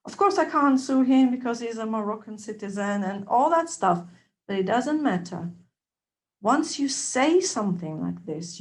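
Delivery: ordinary talk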